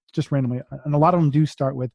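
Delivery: normal talking voice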